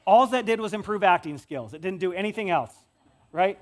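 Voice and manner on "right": usual speaking voice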